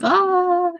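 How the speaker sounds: sudden happy yelp, high; a joyful burst, like the song just hit; excited shout. Retold or imitated. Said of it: "I'm like".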